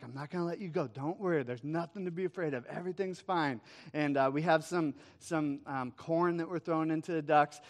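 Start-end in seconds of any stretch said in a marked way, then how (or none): 0.0-3.6 softly acted-out comforting of a toddler; calm a frightened child, hushed; gentle reassurance. Dad reassuring frightened toddler at pond.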